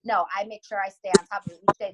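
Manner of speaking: ordinary talk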